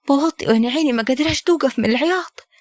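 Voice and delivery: ordinary delivery, nothing marked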